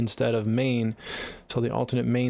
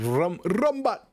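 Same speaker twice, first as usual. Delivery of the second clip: put-on "Rumbot" sung out high and falling in an island accent; playful island accent; 7 semitones higher, much slower than usual. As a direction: toss out "Rumbot" with an overdone island lilt. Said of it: "overdo the island accent".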